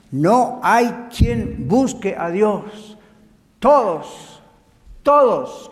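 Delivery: slow, hammered-out Bible verse; emphatic scripture preaching. Real, not acted. Preacher reading Romans to the congregation, emphatic. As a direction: stretch each phrase with weighty force.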